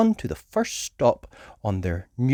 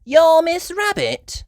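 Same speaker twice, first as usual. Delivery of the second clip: high, slow astonished question; amazed child; surprised gasp; 5 dB louder, slower than usual. Acted. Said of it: "gasped Peppa".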